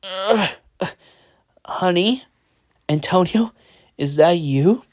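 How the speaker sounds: groggy groan, then high questions; waking up. A narrator's reading. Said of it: "waking up".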